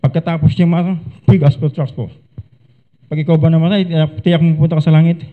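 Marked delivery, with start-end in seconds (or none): 1.2-5.2 blunt stranger's pitch. Shown as a demonstration. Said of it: "magagalit po sa inyo yung kausap niyo".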